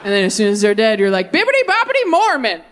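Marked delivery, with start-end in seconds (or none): 1.3-2.6 high singsong fairy-godmother chant; playful wand-waving incantation; mock magic spell. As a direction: cast it like a cartoon spell. Magic spell over the dead.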